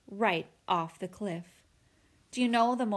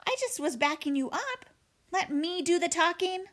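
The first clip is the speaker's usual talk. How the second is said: higher, whining younger brother; whiny little brother; 8 semitones higher than usual. Acted. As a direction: protest like a kid. Little brother defends himself.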